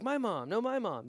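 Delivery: lightly acted, high-pitched squabble; playful bickering. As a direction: toss it back and forth as a fun mock argument. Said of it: "it started fun".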